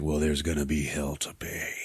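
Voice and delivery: low, soft, trailing vow; acted quiet menace. [name] answering Keith at night, low quiet mock menace.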